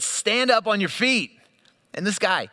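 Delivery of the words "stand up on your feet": high, raised-voice command; loud shout. Retold or imitated. Paul commanding a lame man to rise, loud shout. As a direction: call it out boldly, high and forceful. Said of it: "Paul said in a loud voice, he just shouted".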